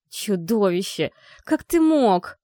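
рычит медленно, потом срывается вверх; прорычи с яростью; growled. A narrator's reading. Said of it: "прорычала я". (прорычала в гневе)

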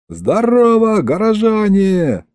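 high, drawn-out public hail; hollered greeting. A narrator's reading. Governor greets the gathered townsfolk, hollering. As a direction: holler it out over a crowd. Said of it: "заорал я".

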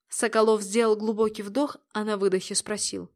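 ordinary delivery

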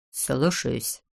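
low, soft mumble; muttered assent. A narrator's reading. Servant taking his master's order, muttering. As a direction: mutter it obediently under your breath. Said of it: "пробормотал Фекс".

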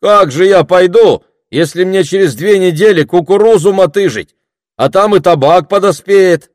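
high, loud objection; surprised protest. A narrator's reading. Villager with corn to hoe, loudly taken aback. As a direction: plead the farm work in disbelief. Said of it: "громко удивился тот же голос".

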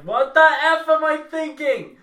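high, loud yell of disbelief; high-pitched mock freak-out; shocked self-scolding outburst. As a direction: shout it like you've just come to your senses. Acting out own shocked realisation.

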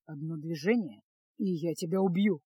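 quiet deadly threat, no heat; cold growl. A narrator's reading. Mage threatening the bandit he holds, coldly. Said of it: "холодно прорычал маг".

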